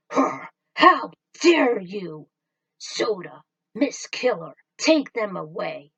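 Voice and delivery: loud, slow indignant command; furious boss calling guards; outraged villain. Acted. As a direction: order them dragged off.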